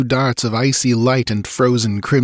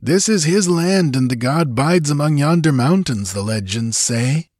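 high-pitched warning, falling away at the end; a touch of superstitious unease; uneasy warrior; 6 semitones higher than usual. A narrator's reading. Warrior warns that the god dwells nearby, uneasy. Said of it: "uneasily muttered a warrior".

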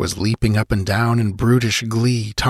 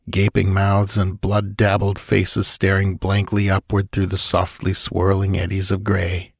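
ordinary delivery

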